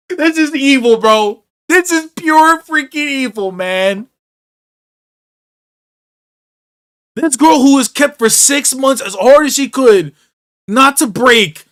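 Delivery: real loud high shout, voice near cracking with grief; anguished shouting. Real, not acted. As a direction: yell it at the screen, heartbroken and furious. Raging at sad anime scene.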